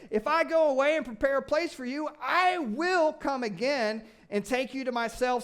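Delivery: high, loud, gleeful reading with cheers; excited cheering. Acted. Preacher to congregation, cheering over the promises. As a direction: cheer each promise like great news.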